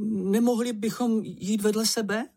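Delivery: high-pitched, hesitant plea; timid Čenich. Acted. Čenich asks to walk side by side, timid high plea. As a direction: ask meekly in a small high voice. Timid figure pleads with companion.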